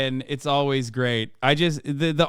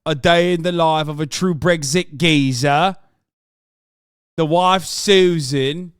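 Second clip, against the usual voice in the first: louder, hammed-up British geezer narration; mock British accent; 2 semitones higher, 6 dB louder, slower than usual. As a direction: play a proud British geezer showing off. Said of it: "Terrible British accent".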